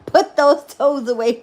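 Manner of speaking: loud, slow words through laughter; laughing hard while teasing. Real, not acted. To a guest about his toes, laughing out loud.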